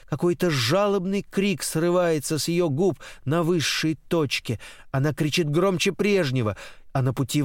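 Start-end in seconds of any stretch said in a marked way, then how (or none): none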